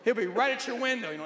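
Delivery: normal talking voice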